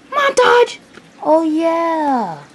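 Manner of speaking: very high, loud "montage"; hammed-up high celebration; gleeful announcement. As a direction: squeal it, then glide down on "yeah".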